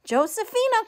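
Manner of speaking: high, loud call across the garden; butterfly calling for his bride. Acted. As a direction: call her name up high. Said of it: "called the butterfly".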